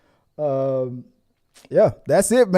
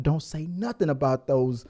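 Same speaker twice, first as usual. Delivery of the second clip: ordinary talk, nothing marked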